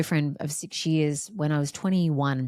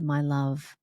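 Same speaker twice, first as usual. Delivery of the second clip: tender loving voice; slower than usual. Retold or imitated. The experience speaking tenderly to her ego. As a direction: gently invite her to receive. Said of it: "obliterated my tiny little ego self and said".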